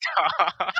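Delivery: laughing while tickled; squealing laugh, begging stop; being tickled. Acted.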